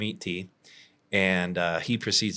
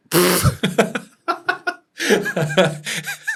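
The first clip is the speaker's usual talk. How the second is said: big laugh; 12 semitones higher, 11 dB louder than usual. Real, not acted. Telling the scam story, cracking up with high laughter. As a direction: burst of high, loud laughter.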